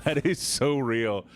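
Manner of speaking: slow, heartfelt agreement; emphatic agreement. Real, not acted. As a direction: agree slowly, pitch gliding down. Agreeing emphatically, first take.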